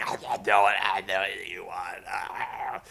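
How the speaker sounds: low, slow, choked voice; mock choking whimper; strangled croak. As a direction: croak like someone being strangled. Strangled Rick response.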